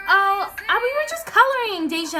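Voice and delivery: high, innocent excuse; imitating Stacy. Stacy excusing herself to the narrator, mock innocent. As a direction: play innocent, pitch up. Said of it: "And she was like".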